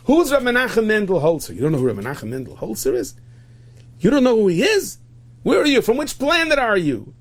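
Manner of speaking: loud, high-pitched disbelief; incredulous outburst. Acted. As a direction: act out scandalized astonishment. Acted incredulous man in story.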